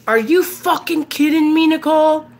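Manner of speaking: high, tense mock-angry dad; pissed-off dad. Dad phoning daughter about the front yard, pissed off. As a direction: imitate an outraged father confronting his daughter. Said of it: "He was pissed".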